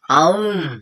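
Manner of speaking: low mouthed tiger roar. Shown as a demonstration. Host shows boss the tiger sound, low roar. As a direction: growl out "aum" like a tiger. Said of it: "suara harimau".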